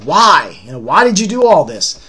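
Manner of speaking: loud, wounded questioning; anguished questioning. Retold or imitated. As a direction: demand an answer, voice rising on why. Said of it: "asking him questions like".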